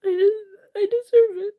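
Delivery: blurting it out through real tears; tearful break. Real, not acted. Streamer to chat, crying that she deserves it.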